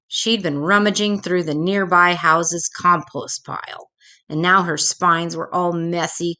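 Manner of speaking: ordinary delivery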